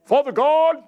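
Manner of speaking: high, hammed-up pious prayer voice; mock religious prayer. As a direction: parody a showy churchy prayer. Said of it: "He's put off by religiousness".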